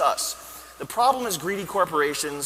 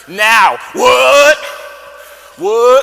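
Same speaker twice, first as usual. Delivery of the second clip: loud, high startled what; sudden shocked outburst; startled shout; 7 semitones higher, 13 dB louder, much slower than usual. Acted. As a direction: yell it as if interrupted mid-speech.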